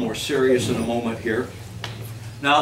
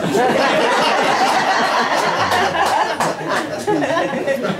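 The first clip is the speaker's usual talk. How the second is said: laugh; 12 semitones higher, 4 dB louder than usual. Real, not acted. Laughs at own joke.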